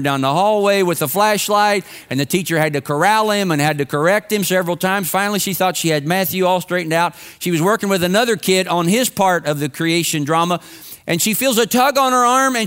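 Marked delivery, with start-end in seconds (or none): none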